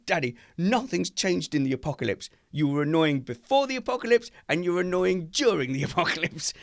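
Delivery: put-on high-pitched complaining child; imitating exasperated daughter. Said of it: "she said to me".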